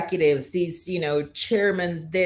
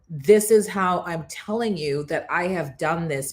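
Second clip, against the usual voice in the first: normal talking voice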